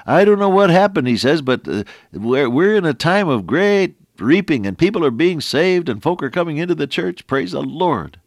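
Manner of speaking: high, loud, joyful report of revival; excited pastor. Retold or imitated. Pastor telling his old friend of great reaping, excited and loud. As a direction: burst out with happy news of souls saved.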